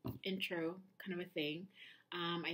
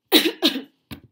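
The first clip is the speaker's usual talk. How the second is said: sharp painful cough; a loud cough that hurts the stitches; coughing after surgery; 10 semitones higher, 22 dB louder than usual. Real, not acted. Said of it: "Coughing still hurts a little bit".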